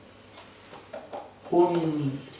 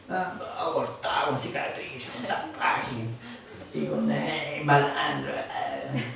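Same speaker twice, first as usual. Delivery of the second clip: hushed mock horror; 4 semitones lower, 7 dB quieter, slower than usual. Describing a dreadful boyfriend.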